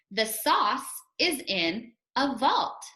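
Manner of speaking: deliberate choral-reading delivery; read-along pace. Shown as a demonstration. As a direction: lead learners slowly through each phrase. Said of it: "Try it with me".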